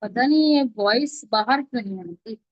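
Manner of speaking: ordinary talk, nothing marked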